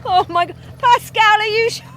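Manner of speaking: high, loud panicked cry to the driver; panicked shriek. Real, not acted. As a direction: shriek it in real fright. Scared passenger calling to driver.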